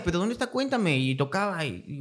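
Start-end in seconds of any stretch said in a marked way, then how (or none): 0.0-1.0 put-on higher, whiny child's voice, pleading; pestering little kid. Retelling how the curious boy pestered him, higher kid voice. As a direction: play the nosy kid who won't let it go. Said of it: "Y el niño insistía".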